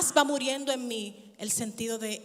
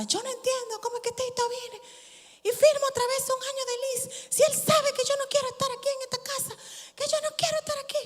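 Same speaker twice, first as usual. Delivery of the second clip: whiny complaint; 11 semitones higher than usual. Retold or imitated. Retelling her own complaint to her sister.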